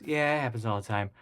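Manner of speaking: casual, matter-of-fact dismissal; retold unconcern. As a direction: imitate brushing off an accident. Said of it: "They didn't even care".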